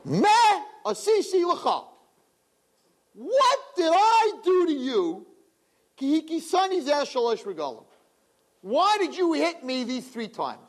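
high, slow, pleading donkey voice; a wounded animal asking why it was beaten; talking donkey. Acted. The donkey asks Bilam why he hit it, high pleading voice. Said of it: "And he says to Bilam".

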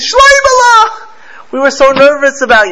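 high, loud relieved parent calling the son's name; a parent overjoyed the boy is alive; mother's relieved cry. Retold or imitated. Parents greeting their lost son, high and relieved.

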